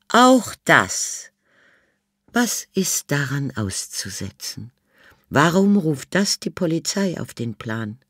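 high, put-on elderly lady, dry; old lady Miss Sophie. A narrator's reading. Old Miss Sophie answers the inspector, high and dry. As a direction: play the haughty old lady.

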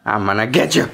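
low, mock-menacing tease; playful threat. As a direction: play the monster coming to get them, low.

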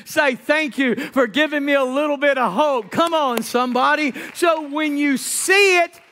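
high, loud, rhythmic exhortation; full-force preaching. Real, not acted. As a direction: urge the congregation on with rising fervor.

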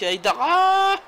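shouting name. Real, not acted. Shouting player name at goal.

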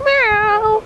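high playful imitated meow; sing-song cat noise; mock cat meow. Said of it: "Is that what you're saying?".

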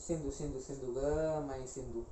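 very soft, low crooning; hushed low murmur; murmured name. Acted. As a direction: repeat the name quietly to yourself. Soft aside repeating the name.

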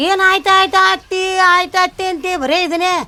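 high, loud mocking echo; mother-in-law mocking her meek answers; mocking mimic. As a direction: throw her own words back at her. Mother mocks daughter-in-law's replies.

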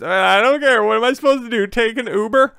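high whiny mock of the wife. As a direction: mimic her in a high indignant whine. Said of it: "And she's".

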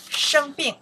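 生病 pronounced loud and high; model pronunciation. Shown as a demonstration. Teacher shows learners how to say sick, loud and high 生病. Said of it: "we say".

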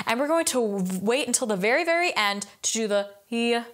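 ordinary delivery

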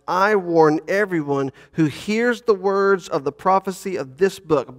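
measured, solemn reading of Scripture. Acted. Pastor reading Revelation to the congregation, solemn reading. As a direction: read the text with reverent weight.

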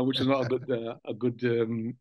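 normal talking voice

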